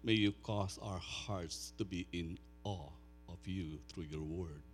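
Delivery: soft, low, reverent prayer voice. Acted. Pastor praying softly over his people before preaching. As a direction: pray quietly over the congregation.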